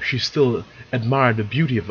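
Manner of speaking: plain reading voice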